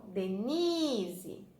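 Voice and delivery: "Denise" slowly, with NI held long; stretched stressed syllable. Shown as a demonstration. Teacher showing where the stress falls, drawn-out NI in "Denise". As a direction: linger on the NI of "Denise". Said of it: "O ni".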